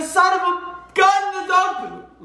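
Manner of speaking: high, slow, drawn-out groan of frustration; exasperated owner. Retold or imitated. Owner finding the dog peed in the other room, exasperated groan. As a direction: play the fed-up owner finding the mess. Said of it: "They go".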